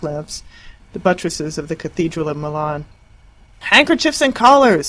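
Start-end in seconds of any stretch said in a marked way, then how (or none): 3.6-4.9 high, loud frantic scream. Acted. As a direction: scream it in a panic. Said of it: "screamed Harriet".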